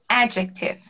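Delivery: adjective with the stress on AD; adjective stressed first. Shown as a demonstration. Teacher corrects the stress of adjective. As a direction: say adjective as AD-jek-tiv. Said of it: "Quando, na verdade".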